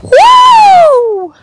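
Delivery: loud high whoop; high cheer. Real, not acted. Cheering a photo update.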